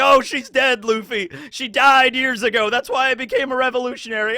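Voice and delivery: high, loud mock-casual dad, rushing the end; mock Dragon. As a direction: toss off tragic news like it's nothing. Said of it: "It's like".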